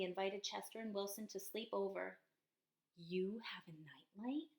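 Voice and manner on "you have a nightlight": slow whispered question. Acted. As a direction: whisper it shyly. Chester asks shyly at night.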